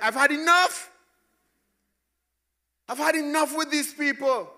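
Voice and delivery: hammed-up exasperation, fed up; exasperated God. Pastor voicing God fed up with the people, loud exasperation. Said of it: "God doesn't talk like that. That's just me".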